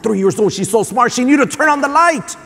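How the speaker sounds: retold proud amazement. Dad marveling at his three-year-old daughter, building excitement. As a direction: voice climbing high with delight.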